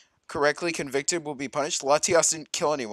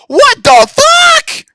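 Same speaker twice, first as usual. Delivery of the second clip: high, loud screaming disbelief; high piercing shout of shock; 16 dB louder, slower than usual. Real, not acted. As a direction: scream it out astonished.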